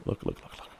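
rapid near-whisper; very soft, voiceless urging; hushed urgency. Real, not acted. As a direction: whisper it under your breath, repeating quickly. Watching the creature he is hunting.

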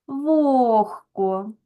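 "вогко" with long О and Г as Х; devoiced Г. Shown as a demonstration. Teacher dictates transcription to pupils, devoiced Г. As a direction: draw out "вогко", a voiceless Х before К. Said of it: "на глухий".

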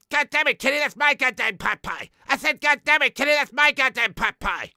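loud, high-pitched bratty cartoon kid; Eric Cartman. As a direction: demand it like a spoiled cartoon boy. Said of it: "my name's Eric Cartman".

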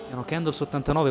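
normal talking voice

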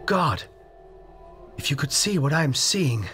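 shaky, frightened high voice; breathless with dread; trembling whisper. A narrator's reading. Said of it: "now called from below in a shaky whisper".

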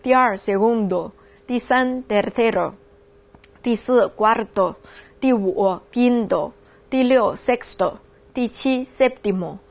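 老师一个个慢慢领读序数词; 放慢语速逐个清楚地读出来; 慢速领读. Shown as a demonstration. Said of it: "再来一遍哈". (带着学生复习的慢速领读)

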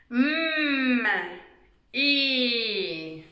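usual speaking voice

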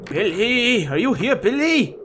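calling out. Pretend. Player searching the creepy house for Billy, calling out. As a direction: call into the house, drawing out the name.

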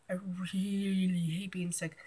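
"really" stretched out long in a soft complaint; stress on really. Real, not acted.

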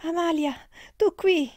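loud, high delighted exclamation; joyful cry of surprise. Acted. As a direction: call out her friend's name in astonished joy.

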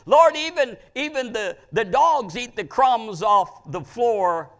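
loud, high, drawn-out plea; preacher's impassioned cry of a begging woman. Retold or imitated. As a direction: cry out to the Lord over everyone. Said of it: "she kept on crying out".

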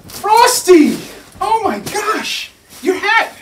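high, loud cry of alarm; alarmed calling out. Acted. Reader cries out to Frosty as his hat blows off, alarmed and loud. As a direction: shout out to the snowman in a panic.

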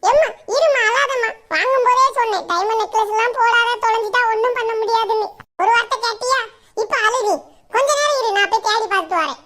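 put-on high, loud girlish scolding; scolding daughter. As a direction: chide her mother for not listening. Daughter scolding crying mother.

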